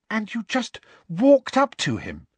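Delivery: high, breathless disbelief; barely able to believe it; breathlessly. Acted. Hermione asks Hagrid, breathless and high. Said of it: "said Hermione breathlessly".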